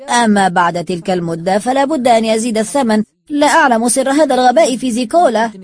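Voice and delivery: loud, high-pitched haggler's complaint; loud, scornful disbelief; exasperated scorn. Acted. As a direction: raise the voice in exasperation at a fool. Haggler scorns the buyer's stupidity.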